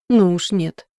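громкий протестующий вопль; выкрикни наперекор; завопить. A narrator's reading. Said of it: "завопил стажёр". (завопить в протесте)

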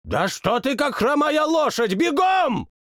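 very high, angry royal scolding; scolding king. Acted. King scolds the servant, very high and angry.

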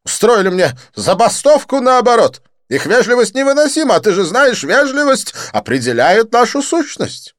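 high, loud, theatrical French friend; excited Didier. Acted. Didier greeting his friend Lin, high excited gush.

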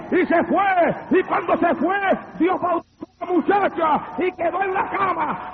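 high-pitched shouted testimony; full-force preaching. Real, not acted. Preacher telling congregation how the demon left, full-force shouted preaching.

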